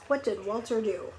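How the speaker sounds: ordinary delivery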